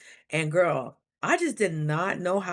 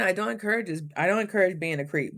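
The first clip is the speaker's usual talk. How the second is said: usual speaking voice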